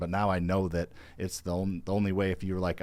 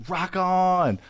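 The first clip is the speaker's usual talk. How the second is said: excited; 10 semitones higher, 5 dB louder than usual. Real, not acted. Hype cheer.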